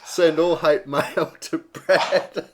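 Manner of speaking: slow, high and loud through laughter; giggling slow joke; laughing. Real, not acted. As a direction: laugh it out while passing the blame. Joking deflection while laughing.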